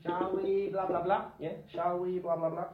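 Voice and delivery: shall we lifted, blah blah trailing; question tag model. Shown as a demonstration. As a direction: sketch the pattern lightly. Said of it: "which is the question tag".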